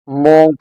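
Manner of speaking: "मॉन्ग" with an o vowel and g-like end; mispronounced "मॉन्ग", rounded vowel; wrong pronunciation. Shown as a demonstration. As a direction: show the students' mistaken "मॉन्ग". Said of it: "इस word को mispronounce".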